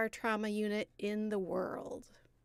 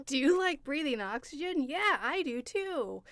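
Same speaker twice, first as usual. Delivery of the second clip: infomercial voice; 6 semitones higher, 6 dB louder than usual. Pretend. Mocking partner's sales pitch.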